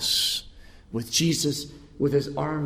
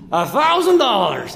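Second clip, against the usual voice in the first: high, loud shout of an offer; called-out bid; 7 semitones higher, 9 dB louder than usual. Acted. Man at the auction making the final bid, calling out. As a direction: call it out to top every bid.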